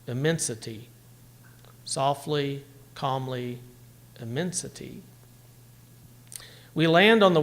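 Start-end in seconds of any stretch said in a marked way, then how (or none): 0.0-0.9 "immensity" as two iambs, weight on MEN and the final TY; im-MEN-si-TY, rocking iambs; stress on MEN. Shown as a demonstration. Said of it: "two I ams". 1.8-5.0 softer, settling reading of the last line; hushed recital. Shown as a demonstration. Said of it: "Listen to how the last line".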